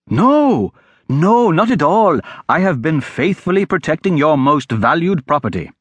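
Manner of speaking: high-pitched lad, protesting innocence; eager street boy. Acted.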